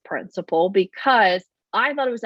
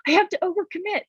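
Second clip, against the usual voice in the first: much higher, strained fake cheer; forced overeager; 11 semitones higher than usual. Nervous live streamer overcommitting, high strained fake cheer. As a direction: play someone forcing big energy. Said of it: "you're just like".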